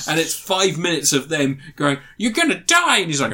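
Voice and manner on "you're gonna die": high-pitched send-up of alarm; mock dire warning. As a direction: cry it out in hammed-up alarm. Mocking film characters' warning.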